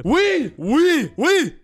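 very high, loud shouted yes; triumphant yell. Real, not acted. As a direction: scream it like you won. Emphatic answer to his own question.

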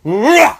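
high, forceful effort grunt; hard-throw grunt. Retold or imitated. Competitive dad throwing hard at his son, straining grunt. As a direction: imitate a dad hurling the ball with a growl. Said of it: "he starts throwing it as hard as he can".

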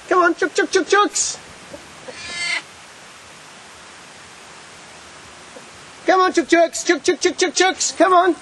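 high sing-song call to the hens; calling chickens. Real, not acted. Calling his chickens to come.

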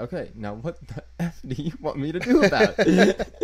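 loud, high mock outburst; exasperated yell. Coworker snapping at a difficult customer, loud mock exasperation. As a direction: bark it out fed up.

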